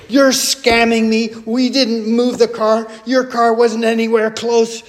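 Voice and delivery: high-pitched indignant retort, acted out; ballistic. Wife hits back at the woman accusing her, acted-out ballistic. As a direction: imitate someone going ballistic in self-defence. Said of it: "my wife went ballistic".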